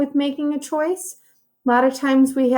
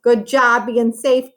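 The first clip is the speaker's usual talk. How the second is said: plain reading voice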